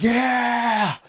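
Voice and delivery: high, hammed-up cheer; excited cheer. Wrestlers cheering after clearing the ring, high mock cheer. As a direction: yell it like a triumphant wrestler.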